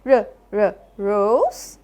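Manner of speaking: r, r, then rose; phonics sounding out. Shown as a demonstration. Teacher checks rose for the r sound, sounding out. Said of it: "does this have the r sound".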